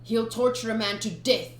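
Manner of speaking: plain reading voice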